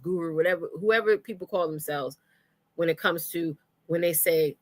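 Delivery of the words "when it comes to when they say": ordinary delivery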